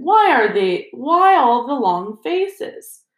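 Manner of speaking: high, buoyant, drawn-out question; beaming good cheer. A narrator's reading. Lockhart to his glum class, breezily cheerful. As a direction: coax them along with overdone jolliness. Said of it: "beaming around him".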